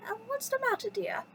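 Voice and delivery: high-pitched motherly teapot voice; acted high, concerned question; Missus Potts. A narrator's reading. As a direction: ask it high, as a storybook housekeeper. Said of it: "asked Missus Potts".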